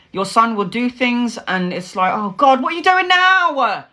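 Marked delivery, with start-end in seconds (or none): 2.1-3.9 high, mock-exasperated wail.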